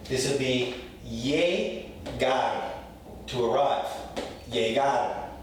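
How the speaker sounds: "llegar" slowly as yeh-GAR; slow "llegar" with a y sound. Shown as a demonstration. Teacher modelling slowly for students. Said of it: "as a Y".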